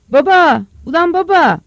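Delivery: loud, high-pitched yell for father; sudden cry. Acted. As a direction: cry out in alarm to your dad. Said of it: "Ansızın bir çığlık duydu". (ansızın atılan çığlık)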